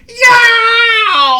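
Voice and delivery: very high, loud cry of pain; yowling wail. Acted. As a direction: scream as hot coffee scalds. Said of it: "he wailed".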